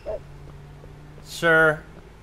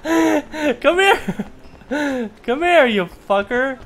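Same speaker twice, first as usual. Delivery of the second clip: high calls broken by laughs; laughing shout; 12 semitones higher, 3 dB louder, much slower than usual. Pretend. Player laughs while chasing the fleeing man. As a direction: cackle while calling him back.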